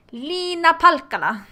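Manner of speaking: name said slowly in a hammed-up Finnish accent; bad Finnish accent. Shown as a demonstration. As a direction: try a clumsy Finnish accent on the name, drawn out and loud. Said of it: "excuse my sucky Finnish accent".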